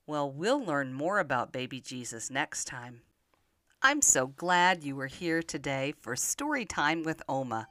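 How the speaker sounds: ordinary delivery, nothing marked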